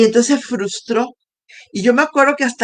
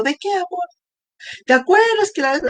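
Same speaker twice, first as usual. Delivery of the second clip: retold husband's reply; 9 semitones higher than usual. Husband replies to her apology.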